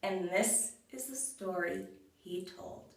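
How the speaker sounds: plain reading voice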